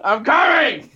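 loud call up the stairs. Real, not acted. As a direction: shout it to someone in another room. Shouting to child upstairs.

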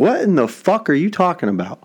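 high, incredulous outburst; what the hell, pitched high; retold outrage. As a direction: disbelief pushed way up in pitch. Retelling his outraged reply.

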